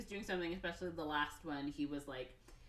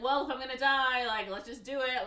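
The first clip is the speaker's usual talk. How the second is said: higher, louder exasperated quote; acted fatalistic outburst; 6 semitones higher, 7 dB louder than usual. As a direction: throw yourself into mock doom. Mickey resigned to die, acted out.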